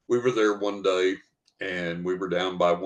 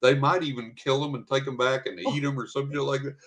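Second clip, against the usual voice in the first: a light imitation of the teacher, higher and giggly; laughing teacher; 6 semitones higher than usual. Teacher explains the hermit story to the hikers, lightly imitated, higher with laughs. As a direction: retell her story with laughter bubbling through. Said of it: "and she said".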